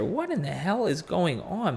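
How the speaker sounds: ordinary talk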